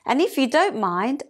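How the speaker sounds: high, sing-song little bird voice; tweeting little bird. A narrator's reading. Little bird asking to join the song, tweeting. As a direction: pipe it up like a small bird. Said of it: "A little bird tweeted".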